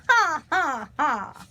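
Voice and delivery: high acted "ha, ha, ha"; mocking crow laugh. A narrator's reading. Crow flying off after taunting, hammed-up laugh. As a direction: cackle it out, falling off. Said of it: "he flew away laughing".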